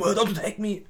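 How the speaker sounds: high mock yell in English; a hint of a frightened protester crying out. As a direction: imitate a panicked shout of don't attack me. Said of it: "schreien rum".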